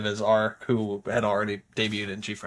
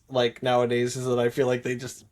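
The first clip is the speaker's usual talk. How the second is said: usual speaking voice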